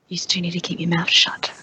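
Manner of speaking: hissed whisper, keep it hushed; whispered scolding. Acted. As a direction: whisper it sharply at them.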